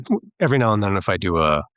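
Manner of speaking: ordinary delivery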